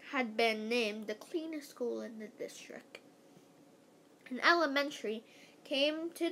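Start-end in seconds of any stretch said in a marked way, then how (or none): 0.1-3.0 secretive whisper. Acted. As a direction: lean in and whisper it. Narrator suddenly whispers.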